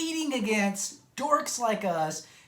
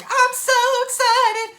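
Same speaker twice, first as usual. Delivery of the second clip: high, loud, slow squeal of excitement; mock-gushing excitement; 7 dB louder than usual. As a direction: gush it out like a giddy fan. Reprising his excited catchphrase.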